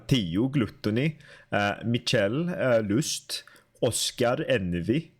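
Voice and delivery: put-on names read in a Swedish accent, "Theo" as "tiu", "Gluttony" as "glutuni"; terrible Swedish accent. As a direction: say "Oscar Envy" with Swedish vowels and rolled r. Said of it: "terrible Swedish accent".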